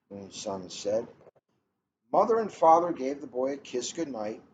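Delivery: gentle, even read-aloud pace; storybook read-aloud. A narrator's reading. Librarian reading a picture book to the room, storytelling voice.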